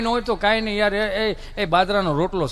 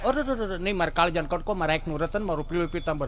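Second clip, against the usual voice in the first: put-on soft, hushed pampering of a child; soothing coo; 4 semitones lower, 8 dB quieter than usual. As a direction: murmur sweet names to the crying child. Parent soothing fallen child. (બાળકને લાડ કરતો ધીમો અવાજ)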